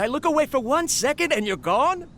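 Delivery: high, rising call for the missing crab. Acted. Abba Tui calling for the wandered-off Karkata, high and exasperated. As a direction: call out, puzzled and a little exasperated.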